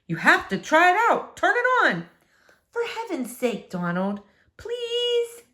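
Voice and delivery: a bubbly wife begging him to turn it on; eager wife. Acted. Mrs Tickham eager, pleading husband.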